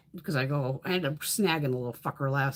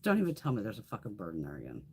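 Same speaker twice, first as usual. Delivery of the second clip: muttered disbelief; 8 semitones lower, 7 dB quieter, faster than usual. Retold or imitated. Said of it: "And I went".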